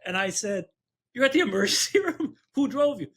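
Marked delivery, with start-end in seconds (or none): 1.1-3.1 high, quick disbelief; acted-out amazement, high and brisk; retold incredulous questions. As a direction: fire off astonished questions, pitched up. Retells disbelief at neighbor's news.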